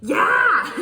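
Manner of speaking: "Yeah" shouted high, loud, cutting; negative yeah. Shown as a demonstration. Teacher showing an angry exclamation: shouted "Yeah". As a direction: bark "Yeah" in irritation. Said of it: "It can be negative".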